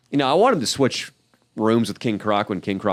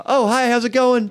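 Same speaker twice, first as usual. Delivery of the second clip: much higher, bright greeting; imitating himself; 12 semitones higher than usual. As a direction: greet her in a high, chirpy voice. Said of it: "and I'm like".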